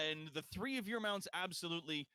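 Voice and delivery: ordinary talk, nothing marked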